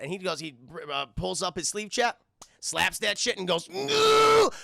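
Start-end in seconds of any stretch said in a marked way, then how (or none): 3.7-4.5 high, loud, sustained "ooh"; mock euphoric cry; drug rush howl. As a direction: let out a rising whoop of a rush. Character slaps on a drug patch.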